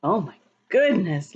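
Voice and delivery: ordinary delivery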